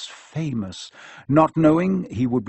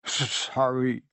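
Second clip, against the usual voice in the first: mild sob; 4 dB quieter, slower than usual. A narrator's reading. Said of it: "sobbed Hagrid".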